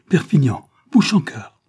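loud, high cries of alarm; horrified recognition. A narrator's reading. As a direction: cry out each name in horror. Horrified recognition of her captors. (cris horrifiés)

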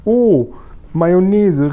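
loud, high, rapturous giant cry; exclaiming. A narrator's reading. Giant hails the Queen at her window, crying out. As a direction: hail her majesty at full voice. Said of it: "rief der Guri".

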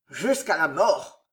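low, drawn-out war cry, held back; slow, understated roar of rallied captains; mild roar. A narrator's reading. Captains answer their commander's call to fight, mildly roaring. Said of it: "rugirent les capitaines".

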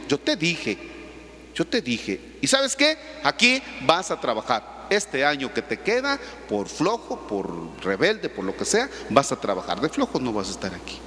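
low, firm verdict; stern father. Retold or imitated. Father in the preacher's story sentencing his lazy son to work, low and firm.

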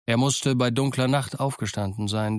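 usual speaking voice

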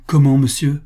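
a stunned exclamation thrown back; dismayed outcry. A narrator's reading. The prince dismayed at Pascal's refusal, crying out. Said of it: "s'écria le prince consterné".

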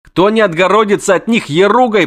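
high, fast, panicky chatter; jittery poet begging his friend; frantic poet. Acted. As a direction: babble in a panic to flee. Voiced poet character.

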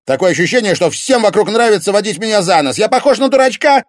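very high, loud indignant shouting; climbing to a bitter yell; furious rant. Acted. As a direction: rage at being fooled. Tim's furious jealous rant.

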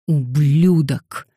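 slow, drawn-out angry hiss; hissed insult. A narrator's reading. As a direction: hiss it slowly with contempt. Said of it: "прошипел Дэниел". (злобно, сквозь шипение)

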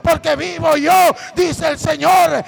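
preaching at full force. Acted. Preacher proclaims Bible verses to the congregation, shouting.

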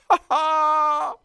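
high loud gloating laugh; triumphant cackle. Acted. Florist cackles in triumph at the customer. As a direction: crow with victory. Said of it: "utbrast Tisten triumferande".

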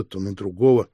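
ordinary delivery, nothing marked

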